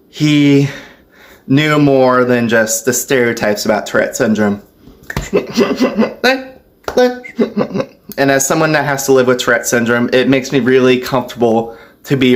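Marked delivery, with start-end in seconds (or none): 5.1-6.4 high vocal tic into a run of hiccup noises; sudden high yelp, then stuttered hiccups; involuntary hiccup tic noises. Real, not acted. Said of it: "you know the hiccup noise I make".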